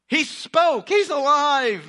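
joyful exclaim. Shown as a demonstration. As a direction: shout it out like sudden good news. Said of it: "could you just imagine".

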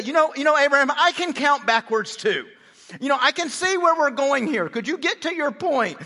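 high, loud, hammed-up impatience; mock exasperation. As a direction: play God fed up with the haggling. Impatient God answers Abraham's countdown.

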